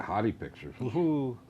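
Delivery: ordinary talk, nothing marked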